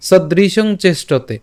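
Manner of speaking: "সদৃশং চেষ্টতে" slow and drawn out; pronunciation model. Shown as a demonstration. Teacher modelling the opening for learners, slow "সদৃশং চেষ্টতে". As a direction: stretch "সদৃশং চেষ্টতে" syllable by syllable. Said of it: "এখন এখানে খেয়াল করুন".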